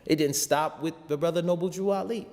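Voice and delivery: normal talking voice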